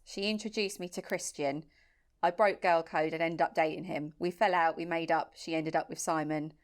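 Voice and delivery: low, soft, even reading voice; subdued, measured read-out; story read-out. Real, not acted. Reads out a sent-in story.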